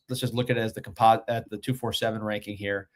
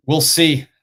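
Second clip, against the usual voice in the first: loud emphatic; 4 semitones higher, 9 dB louder than usual. Real, not acted. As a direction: punch it out, loud and excited. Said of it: "I gotta catch my breath".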